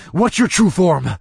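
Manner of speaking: loud, demanding. A narrator's reading. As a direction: demand it hard. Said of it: "I demanded".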